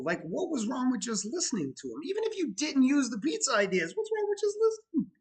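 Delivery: can't believe anyone would be so rude; exasperated. Real, not acted. Ranting to viewers about the disrespectful owners, exasperated.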